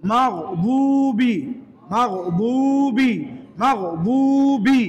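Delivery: মাগদুবি drawn out very slowly ending in bi; slow model recitation. Shown as a demonstration. Teacher recites মাগদুবি slowly for learners. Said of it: "বে না হয়ে হবে বি".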